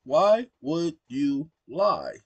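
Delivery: Why, would, you, lie spelled out slowly; slow dictation. Shown as a demonstration. Dictating to the chatbot slowly so it hears lie. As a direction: say every word slowly so the mic gets it. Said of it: "I have to say it slowly".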